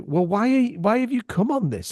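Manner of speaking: high, baffled asking; incredulous. Retold or imitated. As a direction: ask in disbelief why they came. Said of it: "And it's like".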